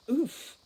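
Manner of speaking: short pained oof; a winded grunt of sympathy. Acted. Foley grunting as Holly slams into the tapestry. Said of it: "grunted Foley".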